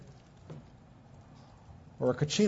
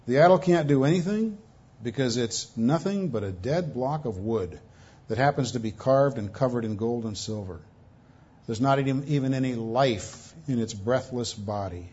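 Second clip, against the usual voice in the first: ordinary talk, nothing marked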